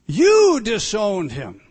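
You disowned him, loud and slow; loud slow accusation. Real, not acted. Preacher accusing listeners of disowning Christ, loud and slow. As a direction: nail them with it.

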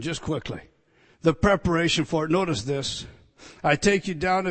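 loud, driving pulpit delivery, pressing each point home; preaching at full force. Acted. Preacher to his congregation about redemption, preaching at full force. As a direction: thunder it out to the congregation.

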